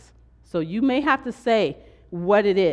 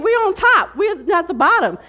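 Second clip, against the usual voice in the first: much higher, loud declaration; triumphant; 11 semitones higher, 7 dB louder than usual. Real, not acted. Preacher rallying her church about faith, loud triumphant proclamation. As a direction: proclaim it like a victory shout.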